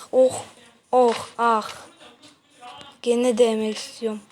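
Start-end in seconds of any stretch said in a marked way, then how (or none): none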